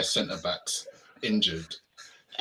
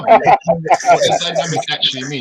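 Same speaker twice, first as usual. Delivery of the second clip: higher and louder, slow and incredulous; slow, louder, higher mock disbelief; mock exasperated question; 8 semitones higher, 12 dB louder than usual. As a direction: drag it out in disbelief. Mocking rant, louder and higher.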